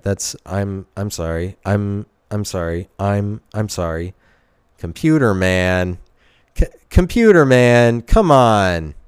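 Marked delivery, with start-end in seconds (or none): 4.8-8.9 exasperated shout. Acted. Shouting at computer voice.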